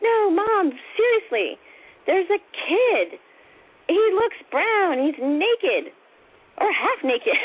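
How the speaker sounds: high, emphatic insistence; retold kid desperate to be believed; pleading child.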